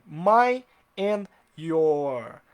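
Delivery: stretched quiz phrase; slow, loud example. Shown as a demonstration. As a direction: write it out with your voice, very slowly. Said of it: "vou colocar aqui".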